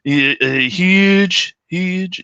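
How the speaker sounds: hammed-up Trump voice; Trump impression. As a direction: stretch the huge like a politician. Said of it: "you know, Trump".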